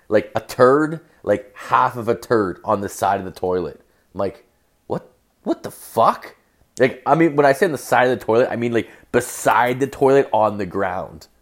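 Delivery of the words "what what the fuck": high, slow rising question; baffled disbelief. Retold or imitated. Man finding a mess by the toilet, baffled. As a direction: squeak it out in mock bewilderment.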